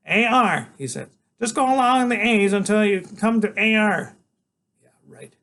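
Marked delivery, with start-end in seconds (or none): 0.1-0.7 higher, louder, grand little knight; small boy waking his big friend for a quest; eager boy genius. Acted. Freak voiced. 1.4-4.2 higher, louder, grand little knight; small boy waking his big friend for a quest; eager boy genius. Acted. Freak voiced.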